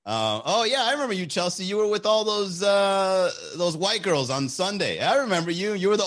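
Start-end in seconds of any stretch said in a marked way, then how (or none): none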